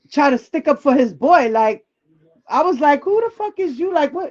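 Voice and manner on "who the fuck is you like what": higher-pitched, softer mock challenge; indignant retort. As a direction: imitate your own incredulous comeback. Acted retort in an argument.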